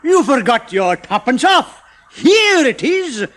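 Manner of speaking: high, slow, loud shopkeeper voice; a fussy alien chemist calling Uncle Roger back. A narrator's reading. Chemist calling the customer back, high and loud.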